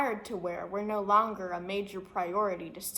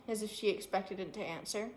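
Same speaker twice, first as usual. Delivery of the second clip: ordinary delivery, nothing marked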